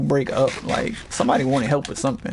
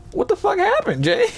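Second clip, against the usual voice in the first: much higher, startled coworker voice; imitating Sandra. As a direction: squeak the question out like a rattled coworker. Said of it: "Then Sandra was like".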